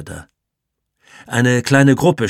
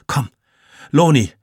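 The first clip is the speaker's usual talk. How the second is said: calling out; 5 semitones higher, 5 dB louder than usual. A narrator's reading. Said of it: "rief Jannik".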